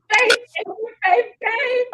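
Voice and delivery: high, overdramatic blubbering; mock crying. Emotional guest imitating herself crying on camera, hammed-up sobbing. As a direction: squeal it out like a tearful meltdown. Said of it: "I'll be crying".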